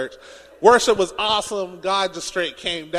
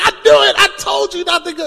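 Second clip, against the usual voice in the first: much louder and higher triumphant yell; told-you-so shout; 12 semitones higher, 8 dB louder than usual. Retold or imitated. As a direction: crow that you saw it coming. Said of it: "And you're like".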